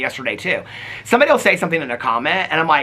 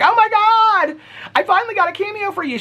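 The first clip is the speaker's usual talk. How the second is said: excited fan; 4 dB louder than usual. Retold or imitated. Fan's message retold.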